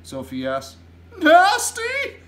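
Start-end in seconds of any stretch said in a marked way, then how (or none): 1.2-2.2 giant's loud, very high exclamation; scandalised high shout; outraged cry. A narrator's reading. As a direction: burst out in disbelief. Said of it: "cried the B F G".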